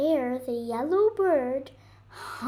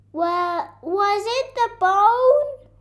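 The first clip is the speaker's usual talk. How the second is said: louder and much higher, slow holler; 8 semitones higher, 11 dB louder, slower than usual. Acted. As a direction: holler across the yard to the bird. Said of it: "Rocket hollered".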